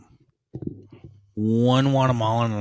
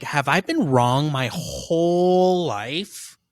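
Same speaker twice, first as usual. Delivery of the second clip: slow, higher, half-mocking inner question; mock self-doubt; 4 semitones higher, much slower than usual. Telling a friend about questioning himself, slow mock dismay. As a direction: drag it out like you're doubting everything.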